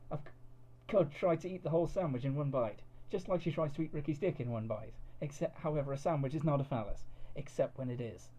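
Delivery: usual speaking voice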